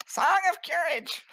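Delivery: high squeaky heroic cry; halfling voice. Acted. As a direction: call it out like a tiny cartoon hero. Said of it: "Like Mickey Mouse".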